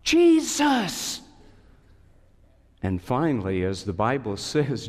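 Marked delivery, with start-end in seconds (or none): 0.0-1.2 high call building to a loud yell; yelling louder and louder. A narrator's reading. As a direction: shout the name, louder each time. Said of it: "keeps yelling louder and louder".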